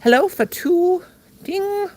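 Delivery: ordinary talk, nothing marked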